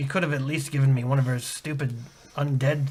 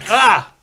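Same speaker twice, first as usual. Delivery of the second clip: pained yelp; 6 semitones higher, 8 dB louder than usual. Acted. Slapped by the other.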